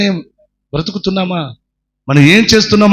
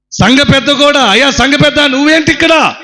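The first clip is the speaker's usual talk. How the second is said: shocked shout; 10 semitones higher than usual. Acted. Mother sees the church elder in the fire, shocked shout. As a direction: shout out in disbelief.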